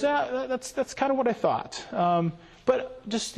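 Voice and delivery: hammed-up high pastor voice, knowing; mock pastor voice. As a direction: play the boss who half-expected it. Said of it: "And he goes".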